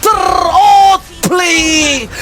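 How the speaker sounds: builds to a long stretched scream; building hype shout. Acted. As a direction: stretch the big call out. Live esports play-by-play.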